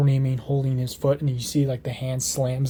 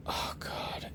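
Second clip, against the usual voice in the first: breathy whispered dread; horrified whisper; 14 dB quieter than usual. Acted. Harry finding Hermione and Rose cornered, horrified whisper. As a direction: whisper it in horror. Sees the girls in danger.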